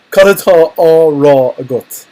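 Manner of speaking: a playful menacing demand; mock threat. Shown as a demonstration. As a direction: press the question with mock menace. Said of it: "could be a threat".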